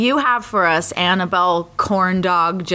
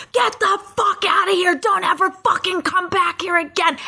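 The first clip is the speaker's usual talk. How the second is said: retold screaming; 8 semitones higher than usual. Customer chasing them off with abuse, mock screaming. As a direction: imitate him screaming after you, volume held in. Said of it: "starts screaming after us".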